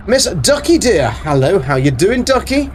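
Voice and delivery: sweet greeting. Real, not acted. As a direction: coo to a beloved pet. Greeting a pet character.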